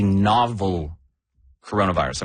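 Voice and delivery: "novel" stretched and set apart before "coronavirus"; stress on novel. Real, not acted. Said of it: "I'm gonna emphasize that word".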